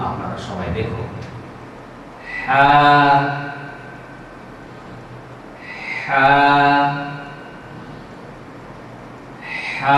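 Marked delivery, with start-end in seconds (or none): 2.5-10.0 হা said from the middle of the throat, spaced out; letter name Ha. Shown as a demonstration. Teacher models হা for students to copy. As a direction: breathe হা from mid-throat, one at a time. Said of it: "এই হরফটির নাম বলছি".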